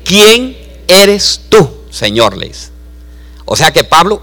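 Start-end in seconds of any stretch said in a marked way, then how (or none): 0.0-2.4 slowly drawn out "¿Quién eres tú"; slow awed question. Shown as a demonstration. Saul asking the voice who it is, slow and awed. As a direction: ask it slowly, in awe. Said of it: "¿Cómo le dijo?".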